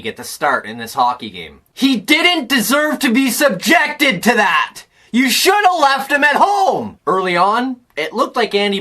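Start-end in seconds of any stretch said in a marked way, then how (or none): 1.7-7.0 raised, emphatic protest; high, exasperated scolding; indignant outburst. Real, not acted. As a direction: burst out in defence of the goalie.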